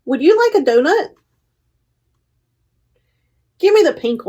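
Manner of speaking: lively picture book read-aloud with paused page turns; reading to children. Acted. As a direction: read it out to a room of little kids, giving each animal a light voice.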